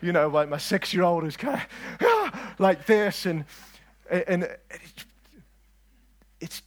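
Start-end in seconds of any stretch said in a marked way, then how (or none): 2.0-2.5 high, loud "ah"; sudden high gasp, mimicking a child; imitated child's gasp. Dad imitating his six-year-old at the film, high gasp.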